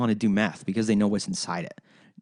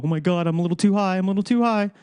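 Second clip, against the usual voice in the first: a higher, louder, hammed-up freaked-out stoner; mock stoned panic; 7 semitones higher, 4 dB louder, faster than usual. Mocking stoners who claim an overdose, whiny hammed-up panic. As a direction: play a pothead fake-panicking over being too high. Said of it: "You go".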